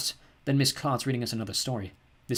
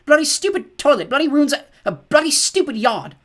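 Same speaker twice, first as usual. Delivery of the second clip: much higher and louder, near tears; tearful rant; 12 dB louder than usual. A narrator's reading. Mum rages at the ruined yard, tearful rant high and loud. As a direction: rant at the yard until the voice breaks. Mum rants, then starts crying.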